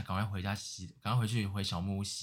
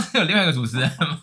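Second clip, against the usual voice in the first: higher and louder, words broken by giggles; laughing; 6 semitones higher, 11 dB louder than usual. Real, not acted. Host retelling own reaction, laughing through it. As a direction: ask it while still cracking up.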